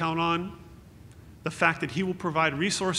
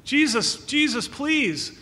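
higher, louder desperate call; yelling for help; 8 semitones higher, 5 dB louder, slower than usual. Retold or imitated. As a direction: call out to him across the crowd. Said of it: "he starts yelling for Jesus".

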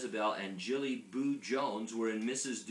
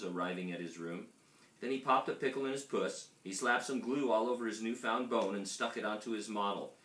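plain reading voice

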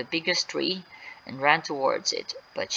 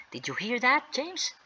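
higher, rising eager question; excited; 8 semitones higher, 3 dB quieter than usual. Acted. Mark to James on hearing Sally, excitedly. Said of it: "Mark said excitedly".

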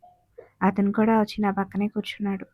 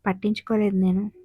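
plain reading voice

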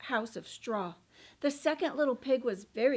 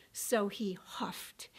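slow soft whisper; 5 semitones lower, 5 dB quieter, slower than usual. A narrator's reading. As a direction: breathe it out. Wolf blowing.

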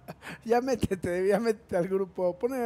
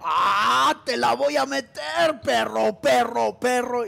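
loud hammed-up troll shout, chanted; 5 dB louder, slower than usual. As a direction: yell the troll taunt again and again. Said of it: "eso significa".

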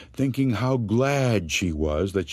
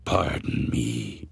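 deep, slow ghoulish politeness; a skull-faced thing asking sweetly; low creepy monster voice; 11 semitones lower, slower than usual. Acted. Horrible thing to the fleeing man, low slow monster voice.

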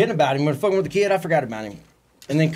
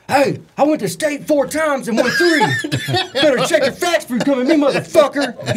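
put-on drunk shout; 10 semitones higher than usual. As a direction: bellow it like a drunk dad showing off. Drunk dad shouting at his son.

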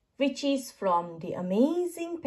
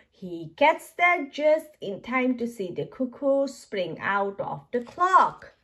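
ordinary delivery, nothing marked

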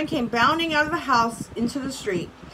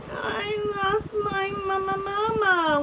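put-on wailing baby; 9 semitones higher, slower than usual. A narrator's reading. Lost baby crying for mama to the tiger, wailing. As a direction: draw it out like a crying little one. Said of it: "wailed the baby".

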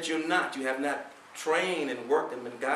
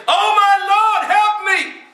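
shouted, much higher and louder plea for help; desperate cry; 16 dB louder, slower than usual. Pretend. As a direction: cry out to God in panic. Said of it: "then we're gonna say".

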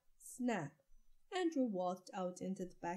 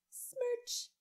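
high squeaky kiss smack; a high, playful smooch noise; kiss sound. A narrator's reading. Mother kissing Andrew goodnight, high squeaky smack.

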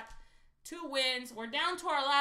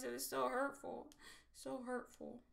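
softer, wounded mock lament; mock hurt; 10 dB quieter than usual. Real, not acted. To viewers about a missed jackpot, softer mock hurt. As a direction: sigh it like a playful heartbreak. Missed million near home.

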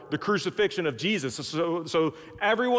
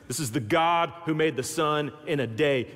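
normal talking voice

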